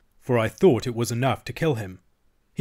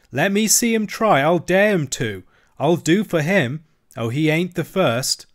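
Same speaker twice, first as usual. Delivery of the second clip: higher, louder defiant bragging; defiant boast; 5 semitones higher, 5 dB louder than usual. Acted. The cabin boy brags he will fight the mate, higher and louder. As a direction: puff up and dare him.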